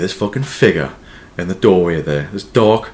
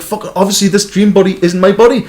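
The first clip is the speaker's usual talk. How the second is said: mock realisation; 10 semitones higher, 3 dB louder than usual. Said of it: "You're like".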